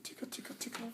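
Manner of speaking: unvoiced whisper tickle chant. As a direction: breathe the tickles softly.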